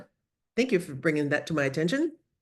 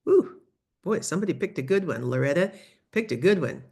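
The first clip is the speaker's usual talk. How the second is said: normal talking voice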